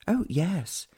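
put-on young lady's voice. Henrietta to the Count.

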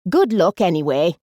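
lightly voiced cheery old lady, lifted at the start; a bright-spirited, breezy send-off; cheerful. A narrator's reading. Old lady leaving the charity shop, cheerful. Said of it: "the old lady added cheerfully".

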